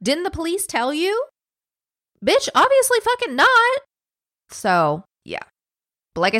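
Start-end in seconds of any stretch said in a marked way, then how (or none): 0.0-1.2 high, mocking prim official; mocking examiner voice. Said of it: "the medical examiner asks her". 2.2-3.8 high, loud indignant snap; host snaps back at the examiner, high and loud; exasperated outburst. Real, not acted. Exasperated retort to examiner's question.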